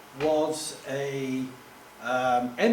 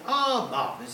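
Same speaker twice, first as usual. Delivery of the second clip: high, posh English exclamation; plummy posh accent, pitched well up; 8 semitones higher than usual. Retold or imitated. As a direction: imitate a delighted posh caller. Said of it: "this very posh voice said".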